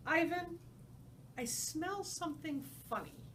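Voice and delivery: put-on high, young little-girl voice; childlike elephant voice. Ruby the young elephant telling Ivan about a funny smell, high childlike voice. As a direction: play a small curious child.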